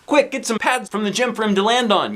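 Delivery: yelled. Acted. Librarian yells urgent orders to staff. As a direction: call out the order fast. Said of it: "yelled Missus Rupee".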